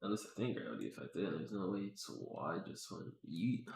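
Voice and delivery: usual speaking voice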